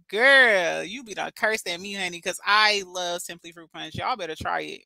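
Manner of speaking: ordinary talk, nothing marked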